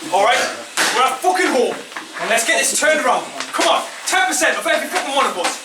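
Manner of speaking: put-on rally the boys, punching every line; halftime pep speech. Halftime team talk, rising intensity.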